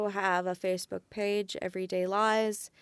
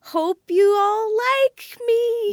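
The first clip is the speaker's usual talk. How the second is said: high, slow, drawn-out pleading; sing-song plea; 12 semitones higher, 10 dB louder, much slower than usual. Pretend. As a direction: stretch it out like a cute plea. Playful sing-song plea to listeners.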